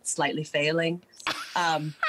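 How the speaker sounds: usual speaking voice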